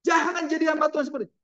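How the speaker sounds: high, fast forbidding command; angry father. Acted. Angry father forbids Francis to become a minister, fast and high. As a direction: rush out the order, furious. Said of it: "Papanya marah dengan Francis".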